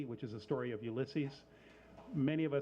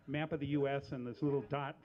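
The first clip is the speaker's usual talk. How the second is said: ordinary talk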